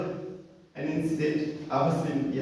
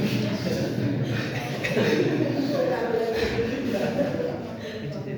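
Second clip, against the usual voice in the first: real laugh; 12 semitones higher than usual. Real, not acted. Laughing at his own marriage wish.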